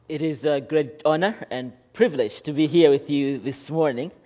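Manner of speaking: usual speaking voice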